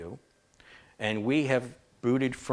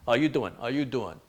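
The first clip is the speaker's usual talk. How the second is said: quick mock New Yorker greeting; New York accent; 2 semitones higher than usual. Streamer teasing how New Yorkers talk, mock New York accent. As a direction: put on a light New York accent, all questions. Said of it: "People from New York, they talk".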